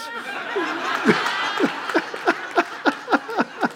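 high, helpless burst of laughter; big laugh. Real, not acted. Preacher cracking up at his own golf joke, big laugh. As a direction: really crack up.

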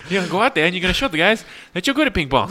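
high, loud, fast mock rallying; hammed-up rousing speech; imitated pep talk. Acting out a ping pong team bit, hyped-up.

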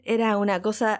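ordinary talk, nothing marked

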